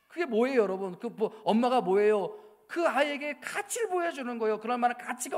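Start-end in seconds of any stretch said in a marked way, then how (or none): none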